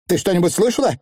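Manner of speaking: high-pitched, sharp demand; sharply questioning. A narrator's reading. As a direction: ask it abruptly, pitched well up. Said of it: "резко спросила анаграмма".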